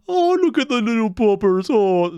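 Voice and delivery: high, loud coo over a puppy. Real, not acted. Cat person melting at little puppers, cooing. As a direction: gush like a besotted dog lover.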